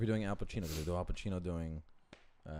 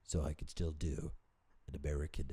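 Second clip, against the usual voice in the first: Michael Caine American accent; 3 semitones lower than usual. Shown as a demonstration. Showing a co-host a hint of Michael Caine doing American. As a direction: keep the English nasal in an American accent. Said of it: "speak like this".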